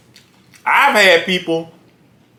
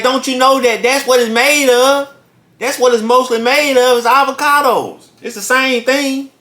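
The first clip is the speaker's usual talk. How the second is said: high, insistent explaining; insistent exasperation; 7 semitones higher than usual. Retold or imitated. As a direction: argue like it's obvious. Retold arguing with worker.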